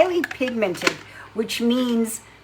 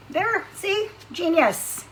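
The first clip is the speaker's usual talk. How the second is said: pleased with herself; 10 semitones higher, slower than usual. Real, not acted. Proud of rubber band trick.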